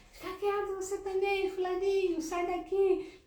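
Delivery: put-on high, loud yell at the kids; mother yelling at kids. Mother mid-argument yelling at her child, loud and high. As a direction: shoo the child off at the top of your voice.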